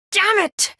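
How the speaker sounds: high-pitched cry of frustration; frustrated outburst. Acted. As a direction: burst out sharply after a sigh. Frustrated outburst in game dialogue.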